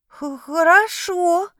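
high, slow stammering kitten voice; puzzled kitten purr. A narrator's reading. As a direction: purr it out, confused little kitten. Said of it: "озадаченно мурлыкнул он".

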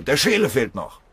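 high, loud alarmed yell; shrieking. A narrator's reading. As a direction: cry out that a man is left behind. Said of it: "kreischte Doll". (laut kreischend)

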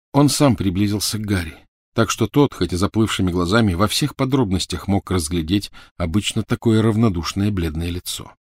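ordinary delivery, nothing marked

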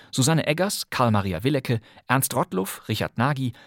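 ordinary talk

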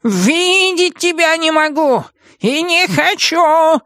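very high, drawn-out witch tantrum; desperate fury. A narrator's reading. Witch stamps at guilty Нептолиум, desperately furious. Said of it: "отчаянно топала ногами Матильда".

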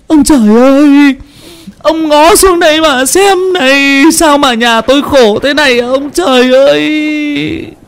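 wailing lament. Acted. Mother Trang cries out to heaven in despair, keening wail. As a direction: wail to the sky in grief.

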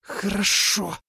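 soft, high hissed yes. A narrator's reading. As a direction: give in with a hiss. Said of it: "прошипела белая змея".

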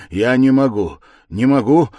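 loud, high, irritated repetition. A narrator's reading. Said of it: "раздражительно повторял он".

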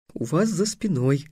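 soft, woeful reply; subdued, mournful little voice; sorrowful. A narrator's reading. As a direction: break the bad news gently and glumly. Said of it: "горестно сказал Трикс".

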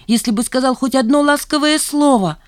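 high, loud, hurt plea; plead, holding back tears; near tears. A narrator's reading. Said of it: "Она всхлипнула". (с обидой, сдерживая слёзы)

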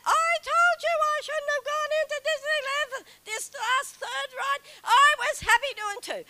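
high, loud hammed-up whine; whinging. Wife whining at her husband after the ride, loud and high. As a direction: wail and complain like a tired child. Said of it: "I whinged even more".

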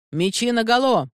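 high, ringing order to the men; commanding call, pitched high; captain's battle command. A narrator's reading. Captain orders swords drawn.

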